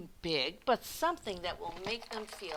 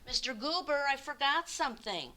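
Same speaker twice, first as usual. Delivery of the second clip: higher, louder call down the sidewalk; 6 semitones higher, 3 dB louder than usual. Acted. Mister Hatch calling after the leaving postman. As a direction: call after him before he goes.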